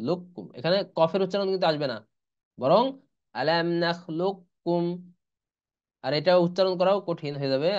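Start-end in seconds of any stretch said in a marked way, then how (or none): 3.3-4.8 আলাম নাখলুককুম very slow, qaf merged into kaf; careful tajweed reading; slow recitation. Shown as a demonstration. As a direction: chant আলাম নাখলুককুম slowly with doubled k. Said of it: "উচ্চারণ কঠিন হয়ে যাবে".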